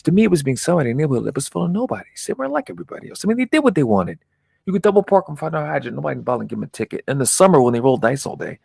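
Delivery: high-pitched, animated storyteller; swaggering neighborhood big shot. Acted. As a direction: build from excited reminiscing into loud bursts. Promoter recalls neighborhood power.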